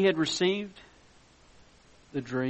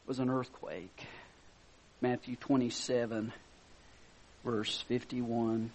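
normal talking voice

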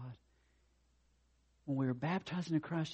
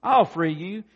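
loud, raised storybook holler; 7 semitones higher, 14 dB louder, slower than usual. Acted. Passerby answers the stuck unicorn, hollering. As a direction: call out the offer to help. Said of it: "I hollered".

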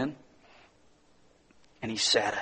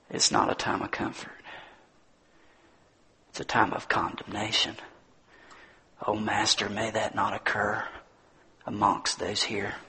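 low, hushed pastoral prayer; solemn prayer; 3 semitones lower than usual. Real, not acted. Leads a closing prayer.

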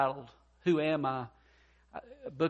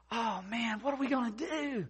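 higher-pitched wail, held back in volume; mock screaming; 7 semitones higher, 5 dB quieter than usual. Business partner watching the business burn, mock panicked wail. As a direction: imitate a distraught partner, softly. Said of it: "He starts screaming".

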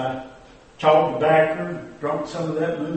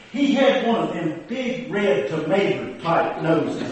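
usual speaking voice

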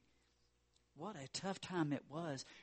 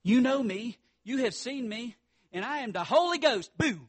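put-on loud, high kid preacher shout; little girl preaching; 9 semitones higher, 12 dB louder than usual. Little girl playing preacher to the kids, loud and high. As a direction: belt it like a bouncing little redhead. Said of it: "she was bouncing around up on stage and she said".

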